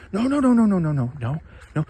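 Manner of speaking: high, rushed mock denial; mock frantic denial. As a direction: shut it down in a panicky squeal. Voiced denial in a skit.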